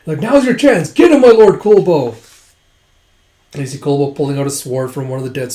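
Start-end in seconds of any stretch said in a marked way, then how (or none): 0.2-2.1 loud, high urging shout; acted rallying call; retold battle cry. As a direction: cheer the lord on in battle. Soldiers urge their lord on.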